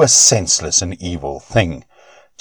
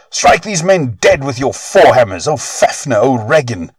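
high, raised call to strike; crying out; 6 semitones higher than usual. Acted. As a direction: order the sons to kill, shouting. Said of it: "their father cried".

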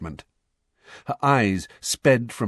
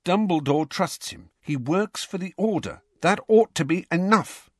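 higher-pitched, exasperated scolding; angry; 8 semitones higher than usual. A narrator's reading. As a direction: argue crossly, pitch raised. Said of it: "said Hermione angrily".

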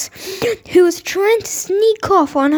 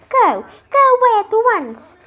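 lightly raised, commanding princess; a touch of bossy princess; 5 semitones higher than usual. Acted. Spoiled princess ordering a frog away. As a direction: shoo the frog off with mild disdain.

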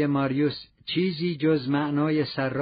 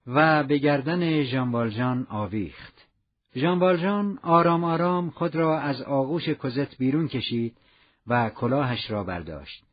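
ordinary delivery, nothing marked

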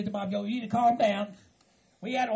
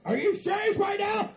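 high-pitched, incredulous shout; riled up; 9 semitones higher than usual. Acted. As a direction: explode in disbelief. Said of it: "You're getting all riled up".

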